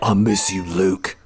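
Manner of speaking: low, hammed-up British pining; mock-gruff British lament; British Batman. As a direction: put on a deep comic British voice for a lovelorn line. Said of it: "British Batman".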